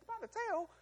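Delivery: a very high, disbelieving question; incredulous squeak. Acted. Moses incredulous.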